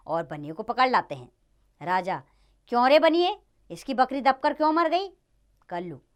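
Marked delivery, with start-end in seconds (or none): 2.7-5.1 high, loud royal demand; acted king's raised, peremptory questioning; accusing interrogation. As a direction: call the accused to account. King interrogates the merchant.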